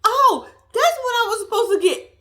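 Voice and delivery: high laughing oh of realization. Retold or imitated. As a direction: burst out laughing as it clicks. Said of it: "I promise you that was my reaction".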